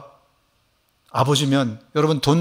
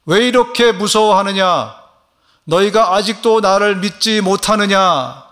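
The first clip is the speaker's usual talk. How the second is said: higher, louder and slower repeat; Jesus rebuking demo; 5 semitones higher, 5 dB louder, slower than usual. Shown as a demonstration. Pastor models Jesus' question for the congregation, slow and loud. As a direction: model the line slowly for all to repeat. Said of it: "한번 따라해 보실까요".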